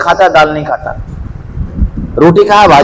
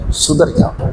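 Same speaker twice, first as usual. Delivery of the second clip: intense whisper; 6 dB quieter, slower than usual. Real, not acted. Preacher urging repentance.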